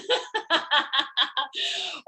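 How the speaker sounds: long, high giggling laugh; delighted laugh. Real, not acted. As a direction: burst into a high run of laughter. Joking the retreat just sold out.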